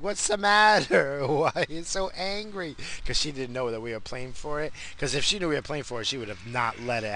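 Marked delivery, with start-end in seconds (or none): 0.0-2.7 high, drawn-out fretting mom voice; imitating mom. Mom asking her storming son what's wrong, high mimicked voice. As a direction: plead like a puzzled mother. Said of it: "and she's like".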